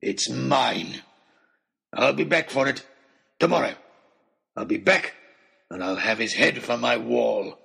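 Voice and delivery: rough, possessive threat; mad hunter, menacing. A narrator's reading. Oxshott vows to return for his trophy, menacingly. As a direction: promise it in hard, separate bursts.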